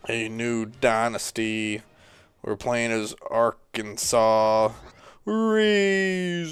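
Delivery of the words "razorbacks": drawn-out, high-pitched fan call; team cheer. Pretend. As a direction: stretch it out high like a team chant. Team name called as a cheer.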